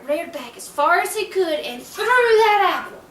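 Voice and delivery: usual speaking voice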